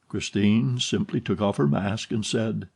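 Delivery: ordinary delivery, nothing marked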